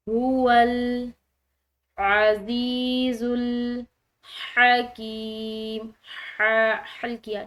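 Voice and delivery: الحکیم with ح from the throat, slow and loud. Shown as a demonstration. Teacher showing the throat ح in الحکیم, slow loud chant. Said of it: "یہ حلق سے ادا ہونے والی ہا ہے".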